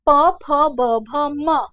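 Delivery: प, फ, ब, भ, म loud and separate from the lips; labial consonant demo. Shown as a demonstration. Teacher showing air meeting the lips, loud labial consonant demo. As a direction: punch out प, फ, ब, भ, म with the lips. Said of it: "वो ओष्ठ को स्पर्श कर रही है".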